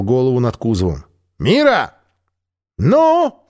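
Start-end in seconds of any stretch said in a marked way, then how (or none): 1.4-1.9 calling out. Acted. Альп зовёт Миру, громкий окрик. Calling her across battlefield. 2.8-3.5 very high, loud curt shout; shouted reply. Acted. Мира нетерпеливо кричит Альпу в ответ. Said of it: "крикнула она".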